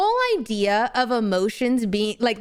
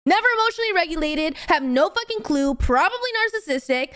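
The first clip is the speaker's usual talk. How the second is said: real, higher-pitched indignant rant; getting heated; 5 semitones higher than usual. Real, not acted. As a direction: rant with rising exasperation. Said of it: "Sorry, I'm getting heated".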